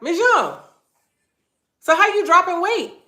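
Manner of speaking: high, loud astonished exclamation; imitating a doctor's loud disbelief; amazed doctor. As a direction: call out in exaggerated amazement, pausing after the name. Doctor amazed at her weight loss.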